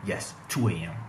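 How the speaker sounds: normal talking voice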